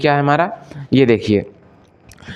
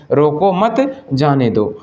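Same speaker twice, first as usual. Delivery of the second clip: रोको मत together, a pause, then slow जाने दो; pause after मत; much slower than usual. Shown as a demonstration. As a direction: break the phrase right after मत. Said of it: "मत के पास में विराम चिन्ह है". (मत के बाद विराम)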